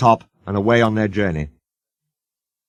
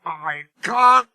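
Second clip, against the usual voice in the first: grumbling; 8 semitones higher, slower than usual. A narrator's reading. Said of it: "he grumbled".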